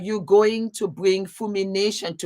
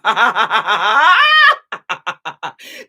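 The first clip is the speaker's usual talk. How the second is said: loud laugh; 2 semitones higher, 10 dB louder than usual. Real, not acted. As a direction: let a big laugh burst out. Bursts out laughing on stream.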